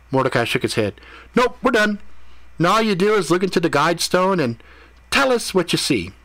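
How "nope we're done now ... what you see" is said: high-pitched, lively character voice; upbeat, matey guide. Acted. Mordecai directs Ethan to the guide stone, high lively instruction. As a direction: wrap it up and send him onward.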